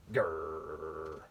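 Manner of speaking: low growl from the giant. Acted. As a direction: rumble a low angry growl.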